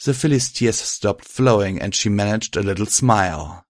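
plain reading voice

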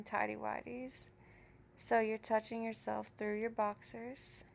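soft seductive voice. Acted. Teasing, softer seductive talk.

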